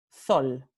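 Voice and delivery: sol with th, thol; tongue between teeth for sol, thol; ceceo sol. Shown as a demonstration. Teacher showing ceceo on sol. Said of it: "With the tip of the tongue in between".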